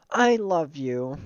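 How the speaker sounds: slow, dopey declaration in character; goofy kid voice. Acted. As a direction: stretch it out like a lovestruck goof. Voicing the stupid character.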